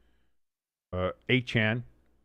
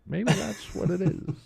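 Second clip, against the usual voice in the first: Bill Clinton impression; 8 semitones higher than usual. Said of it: "I'm doing the Bill Clinton here".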